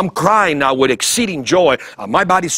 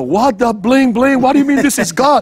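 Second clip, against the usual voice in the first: incredulous friends; 8 semitones higher, 4 dB louder than usual. Retold or imitated. Friends in disbelief at his claim.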